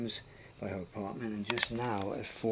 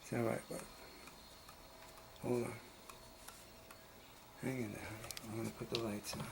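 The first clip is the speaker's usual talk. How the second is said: soothing a scared dog; 10 dB quieter, faster than usual. Real, not acted.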